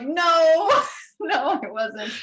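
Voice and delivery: giggling no, no; laughing denial. Retold or imitated. Denying an alien abduction.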